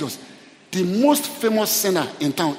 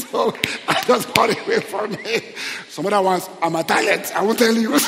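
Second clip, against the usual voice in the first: real amused laugh in the words; laughing while talking; 2 semitones higher than usual. Real, not acted. Preacher joking about his other talents, laughing while talking. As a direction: talk through your own laughter. Laughing over own smoking story.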